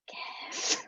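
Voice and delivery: voiceless ghostly whisper. Acted. Unseen ghost urging two trapped friends to kiss, whispered. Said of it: "they just hear the whispers".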